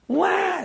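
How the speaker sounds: high imitated baby wail. Rabbi imitating the crying baby in the car, high wail. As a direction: mimic a newborn's cry, loud and high.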